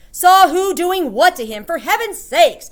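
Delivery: loud, high exasperated shouting; full-voiced irate husband; shouted. A narrator's reading. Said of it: "shouted Mister Jenkins".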